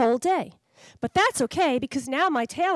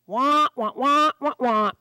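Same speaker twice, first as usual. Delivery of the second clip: louder wah-wah gibberish; dog imitating the owner's speech as noise; mock grown-up babble; 6 dB louder, slower than usual. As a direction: imitate talk the dog can't follow. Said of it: "sounds coming out of his mouth".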